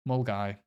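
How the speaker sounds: "Mulgai" said as "mul-guy"; two syllables in "Mulgai", the last rhyming with "eye"; correct local pronunciation. Shown as a demonstration. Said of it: "It's Mulgai".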